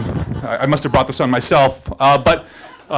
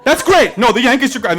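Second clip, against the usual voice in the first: high, louder, eager to please; eager agreement; 6 semitones higher, 4 dB louder than usual. Retold or imitated. Teller relieved, agreeing with the soldier about baseball, high eager voice. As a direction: agree fast and brightly.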